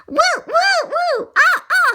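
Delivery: high loud woo woo cries; high loud whooping; loud high whoops. Acted. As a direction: whoop like wild cats. Cats wreck the room.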